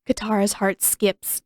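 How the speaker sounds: plain reading voice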